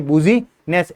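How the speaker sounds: "बुजीनेस" said as bu-zi-ness, each spelt letter sounded; spelling trick said as "बुजीनेस" to remember business; memory-trick pronunciation. Shown as a demonstration. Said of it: "बुजीनेस याद रखो आप तो".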